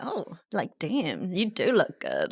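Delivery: hushed, very soft approval; impressed self-admiration. Retold or imitated. Admiring self alone in bathroom, hushed and impressed. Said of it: "be like".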